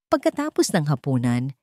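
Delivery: ordinary delivery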